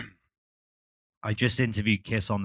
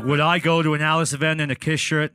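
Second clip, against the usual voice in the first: louder, higher scoffing Gene; mock indignant Gene; 6 semitones higher, 6 dB louder than usual. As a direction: berate him for the wrong shirt. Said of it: "He was like".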